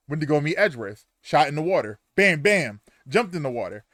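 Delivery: usual speaking voice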